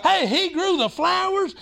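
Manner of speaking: high-pitched, fervent sermon; excited preaching. Real, not acted. Preacher telling the congregation to get excited about God, fervent.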